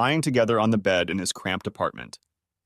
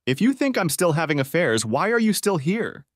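high, heated challenge building to a higher pitch; rising angry; 7 semitones higher than usual. Acted. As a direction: push back harder at the wife with each question. Husband argues with wife.

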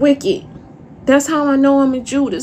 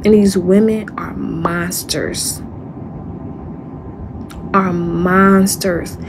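slow emphasis; 4 semitones lower, slower than usual. Real, not acted. Slow emphatic repeat about enemies.

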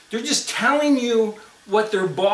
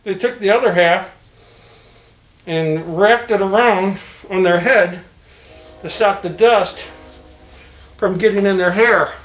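muffled behind fabric; through a cloth mask; 2 semitones higher, 8 dB louder than usual. Real, not acted. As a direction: mouth covered by cloth.

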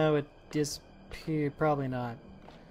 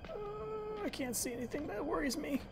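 nervous hush; 12 semitones higher, 11 dB quieter than usual. Real, not acted. Can't see, nervous hush.

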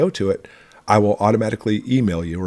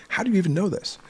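mock-surprised question, pitched far higher; a startled, high "how do you know?"; 11 semitones higher than usual. Answering martial arts friends who know he preps, mock surprise.

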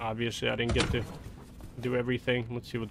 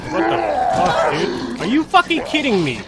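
furious, much louder and higher gamer rant; real angry yelling; 7 semitones higher, 5 dB louder than usual. Real, not acted. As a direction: blow up at the game, yelling. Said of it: "I'm getting mad".

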